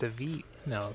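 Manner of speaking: ordinary delivery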